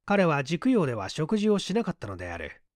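ordinary delivery

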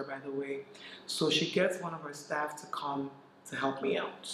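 ordinary talk

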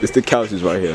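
usual speaking voice

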